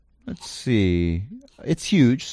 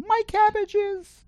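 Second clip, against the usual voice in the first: tiny, very high-pitched distant cry; high little voice wailing in mock dismay; small voice; much slower than usual. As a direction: squeak it out slowly, way up high. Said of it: "a small voice go".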